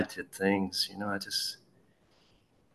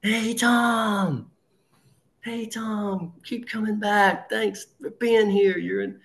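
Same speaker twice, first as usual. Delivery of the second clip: high, over-the-top group greeting; mock bullhorn cheer; 12 semitones higher, slower than usual. The AA crowd greets newcomer Tom, mock bullhorn cheer. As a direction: cheer him like a crowd through a megaphone. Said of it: "with bullhorns and screaming".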